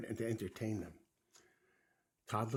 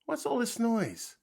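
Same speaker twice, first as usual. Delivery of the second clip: high, loud demand; indignant shout; 12 semitones higher, 7 dB louder than usual. A narrator's reading. Frog running up to noisy Toad, loud and indignant. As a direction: cry out over the racket.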